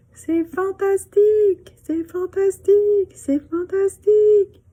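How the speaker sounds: high repeated cry fading like an echo. Acted. Mountains echoing back the girl's cry. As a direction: let it bounce back from the mountains. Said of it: "echo of her cry".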